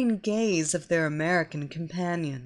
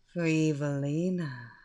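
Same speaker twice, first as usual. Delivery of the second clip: sultry hypnotic voice; 6 semitones lower, 3 dB quieter than usual. Acted. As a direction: draw them into a trance, unhurried. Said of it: "the sultry sound of my hypnotic voice".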